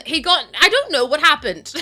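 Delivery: ordinary talk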